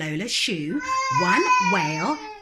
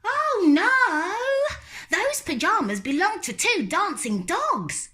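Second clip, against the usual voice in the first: put-on high, stubborn little-girl voice, louder; little girl refusing; 13 semitones higher, 4 dB louder than usual. Lola refusing to go to bed, high and stubborn. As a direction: dig in like a stubborn small child.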